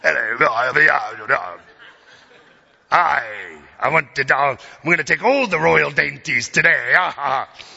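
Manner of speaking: loud, hammed-up pirate. Preacher mocking own British attempt, greedy pirate voice. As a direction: belt it out like a comic pirate, ending in a laugh. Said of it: "I sound like a pirate".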